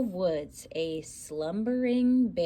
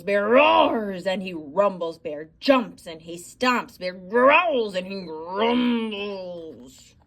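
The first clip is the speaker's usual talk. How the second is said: loud, big, growling narration; rumbling bear; 8 dB louder than usual. A narrator's reading. Storyteller booms as the bear wakes, loud and big.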